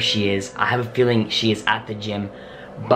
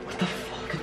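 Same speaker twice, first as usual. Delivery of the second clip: whispering; 11 dB quieter than usual. Real, not acted. Board moves on its own.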